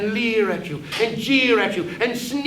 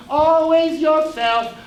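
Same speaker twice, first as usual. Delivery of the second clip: always drawn out and louder; stress on always; 8 semitones higher, 6 dB louder, slower than usual. Acted. Conscience mocking master, always stretched and loud. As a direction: hit always hard and slow.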